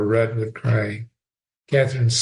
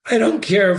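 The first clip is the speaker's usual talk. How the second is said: sobbing; 8 semitones higher than usual. A narrator's reading. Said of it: "Ferguson sobbed".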